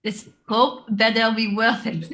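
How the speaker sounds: put-on French accent. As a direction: struggle through the French accent and crack up. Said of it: "a hard thing to say in a French accent".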